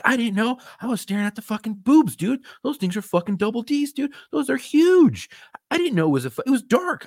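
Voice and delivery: high, rising protest; defensive panic. Retold or imitated. Officer defending himself to his partner in a high, frantic voice. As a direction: yelp it up high, pleading innocence. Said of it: "I was like".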